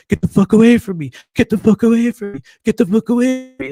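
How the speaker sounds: high, loud, fast repeated yell; broken robot repetition. Retold or imitated. The man yelling at the narrator to get away, high fast robotic loop. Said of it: "repeating it like this weird broken robot".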